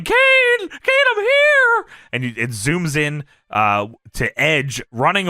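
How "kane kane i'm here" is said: high, loud mock yell; hammed-up high wail; Paul Bearer. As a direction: call out as if from a distance. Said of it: "Paul Bearer in the distance yelling".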